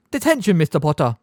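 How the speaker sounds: a touch of smug triumph; teacher pleased to give detention; triumphant. A narrator's reading. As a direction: savour handing out the punishment. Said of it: "said Professor Umbridge triumphantly".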